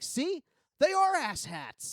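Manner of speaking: triumphant told-you-so in a high voice; mock gloating, dragged out at the end; vindicated gloat. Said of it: "And I'm like".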